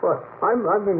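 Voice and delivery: normal talking voice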